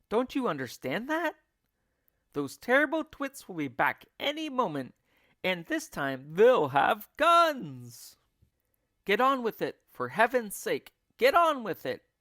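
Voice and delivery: high, loud, exasperated urging; frantic urging. A narrator's reading. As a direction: push them to hurry, near panic. Frantic leader urging animals.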